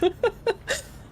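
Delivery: high awkward giggle; a quick nervous titter; nervous laugh. Real, not acted. Embarrassed about her laugh, high nervous giggle. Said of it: "nervous laugh".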